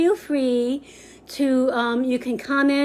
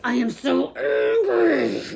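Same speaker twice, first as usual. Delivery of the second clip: higher, slow mock anger; hammed-up angry; 5 semitones higher, slower than usual. Shown as a demonstration. Teacher showing students an angry face, hammed up. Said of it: "show the face".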